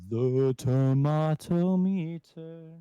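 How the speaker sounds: very slow, loud, booming announcement rising in pitch; segment announcer. Pretend. Co-host introduces the rating segment, drawn-out announcer call. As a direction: stretch it out like a big game-show title.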